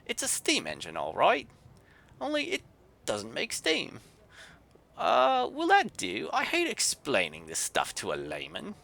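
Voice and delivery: a cross Yorkshire artificer grumbling; Yorkshire grumble. Acted. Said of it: "in a thick Yorkshire accent".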